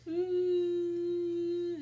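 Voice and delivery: long, high, loud crying wail; mock sob. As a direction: pretend wail of despair. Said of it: "crying and crying and crying".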